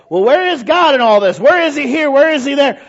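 high, loud cry of disbelief; mock outraged questioning; exasperated questioning. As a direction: demand answers, pitched right up. Acting out upset news watcher.

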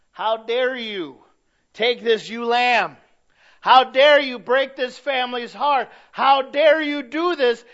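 loud, slow, rising indignant fury; furious outrage. Retold or imitated. Preacher voicing King David's outrage at the rich man, furious shout. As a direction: thunder it out in righteous anger.

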